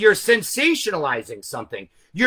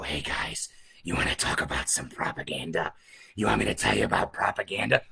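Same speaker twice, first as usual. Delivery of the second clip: hushed, much lower and much softer creep voice, faster at the end; mock creepy whisper; 11 semitones lower, 9 dB quieter than usual. Mocking how a whispering streamer would sound to viewers, creepy whisper. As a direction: play a creepy streamer luring the chat in. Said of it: "I sound like a creep".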